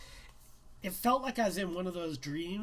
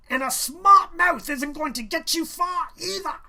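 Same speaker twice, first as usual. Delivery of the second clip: loud, high angry outburst; furious teacher shouting; 10 semitones higher, 13 dB louder than usual. A narrator's reading. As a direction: snap it at the smart-mouthed student. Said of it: "he said angrily".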